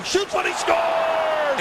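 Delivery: very high, slow goal scream; long goal yell. Real, not acted. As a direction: stretch out he scores at full pitch. Goal call, drawn out.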